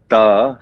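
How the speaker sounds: ta said "tuh", rhyming with "the"; isolated syllable. Shown as a demonstration. Musician teaching the first syllable, ta as "tuh". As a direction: sound out ta alone with a short "uh". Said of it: "almost like how you would say the".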